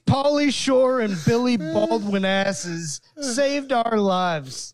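high, slow mock indignation; hammed-up disgust, drawing out each insult; mock mad. Scientists griping about the podcasters who saved them, mock outraged. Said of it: "they're, like, super fucking mad".

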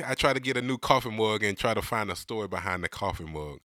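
ordinary talk

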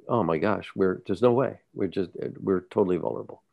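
ordinary talk, nothing marked

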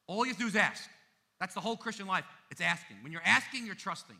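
loud, high, ringing preacher's call; full-force preaching. Acted. Pastor urging the congregation toward new life, loud preaching. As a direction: preach it out to the back row.

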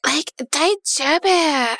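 put-on slow, drawn-out American-accented Japanese; hammed-up American accent on Japanese. [name] keeps mocking her own Japanese, slow American accent. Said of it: "my extreme accented foreign languages".

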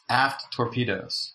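ordinary talk

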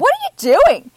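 very high, loud incredulous squeal; mock disbelief. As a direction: shoot the pitch way up in mock outrage. Guests reacting to the stranger host.

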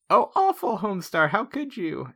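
high-pitched, shocked and scolding; high indignant voice. Retold or imitated. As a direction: gasp it out, very high and hurt. Voicing Marzipan's outraged reproach.

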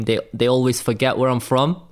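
ordinary delivery

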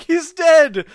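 high, loud acted exclamation; hammed-up cry of discovery; mock alarmed shout. Acted announcement of a death.

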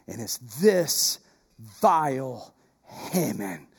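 slow, low, soft, dragging out vile; accusing Haman. Retold or imitated. Esther naming Haman to the king, slow and accusing. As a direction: lean hard and slow into vile. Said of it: "And Esther said".

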